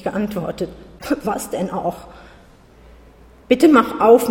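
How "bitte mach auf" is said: loud, pleading plea. A narrator's reading. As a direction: beg her to open the door. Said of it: "seine Stimme klang auf einmal flehentlich". (flehend und laut bittend)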